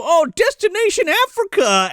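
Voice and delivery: high-pitched hammed-up travel call; mock announcement. Calling the destination to the fairy, high mock announcement. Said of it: "They go".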